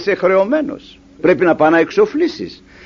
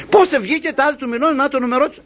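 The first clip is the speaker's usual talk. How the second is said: higher, protesting question; indignant citizen; 6 semitones higher than usual. Acted. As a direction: push back at the clerk in disbelief.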